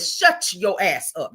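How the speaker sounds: slow and emphatic; "Shut your ass up" with weight on every word. Shown as a demonstration. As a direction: drive it home slowly. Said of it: "You should have said".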